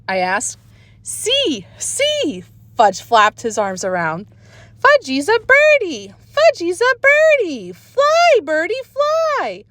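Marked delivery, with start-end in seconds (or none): 1.0-2.4 put-on toddler squeal. A narrator's reading. Toddler shows off. 4.8-9.6 put-on high, slowing childish cry; excited toddler. A narrator's reading. Toddler Fudge on the jungle gym, high excited cry.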